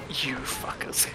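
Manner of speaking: soft unvoiced whisper; whispered curse. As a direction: hiss it under your breath. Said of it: "and is just like".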